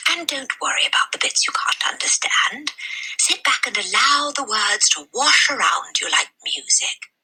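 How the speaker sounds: high, breathy librarian voice; a concerned lady asking gently; kindly librarian. A narrator's reading. Mrs Phelps voiced.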